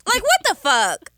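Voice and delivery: loud, slow disbelief; outraged. Real, not acted. Outraged reaction.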